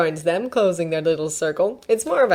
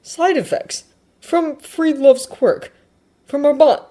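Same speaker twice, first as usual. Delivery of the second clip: higher, louder character voice; worried questioner; 9 semitones higher, 6 dB louder than usual. Acted. Worried character questioning a friend's side effects, anxiously. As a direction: ask in raised, anxious bursts.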